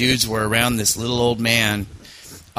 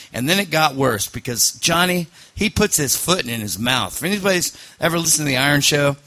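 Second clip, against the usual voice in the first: usual speaking voice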